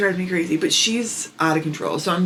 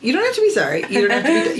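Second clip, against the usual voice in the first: laughing reassurance; 10 semitones higher, much faster than usual. Real, not acted.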